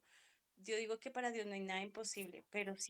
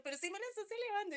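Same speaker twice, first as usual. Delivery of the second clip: mucho más aguda y fuerte, rápida; alzando la voz; 12 semitones higher, 4 dB louder, much faster than usual. Retold or imitated. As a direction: reclamar a la hija subiendo el tono. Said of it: "comencé a elevar mi voz". (la madre le reclama a su hija, aguda, fuerte y rápida)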